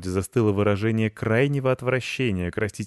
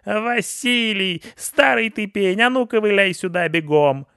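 very high, loud, drawn-out yell; siren-like bellow; 13 semitones higher, 7 dB louder than usual. A narrator's reading. Pensioner summoning husband to wrecked flowerbed, bellowing. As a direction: screech like a fire siren. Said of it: "то заревела, как пожарная сирена".

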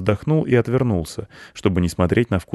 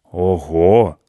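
louder wow at the narrator's pitch; amazed cats; 5 dB louder than usual. A narrator's reading. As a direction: exclaim it like impressed cats. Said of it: "сказали коты".

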